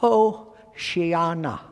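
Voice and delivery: Hoshiana said slowly, oh-shee-AH-nah; slow, careful Hebrew Hoshiana; Hebrew word Hoshiana. Shown as a demonstration. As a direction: spell out Hoshiana slowly, syllable by syllable. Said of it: "the Hebrew phrase is".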